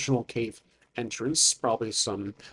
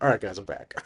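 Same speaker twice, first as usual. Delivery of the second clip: Mel's character voice; 6 semitones lower, 4 dB quieter, faster than usual. Acted. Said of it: "He's like".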